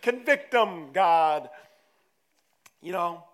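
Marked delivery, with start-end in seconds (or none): 0.0-1.5 loud, emphatic plea to God; preacher's loud convict them, God; loud prayer. Real, not acted. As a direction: call on God, hammed up with force. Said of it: "And then God can convict them".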